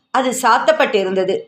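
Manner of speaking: ordinary delivery, nothing marked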